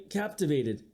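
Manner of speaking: plain reading voice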